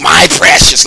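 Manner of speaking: high, loud creature rasp; covetous little creature impression; Smeagol. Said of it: "Man, I Smeagol".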